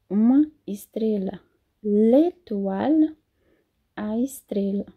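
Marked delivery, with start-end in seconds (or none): 1.8-3.2 slow demo. Shown as a demonstration. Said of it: "professora demonstra pronúncia devagar".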